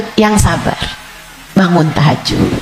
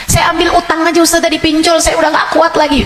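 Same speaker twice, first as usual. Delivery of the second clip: complaining congregant; 8 semitones higher, faster than usual. Retold or imitated.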